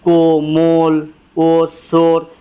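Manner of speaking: slow examples with held 'o' in को, मोल, ओस, शोर; majhool waaw. Shown as a demonstration. Teacher listing examples for the class, round o held slowly. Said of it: "इसे वाव मजहूल कहा जाता है".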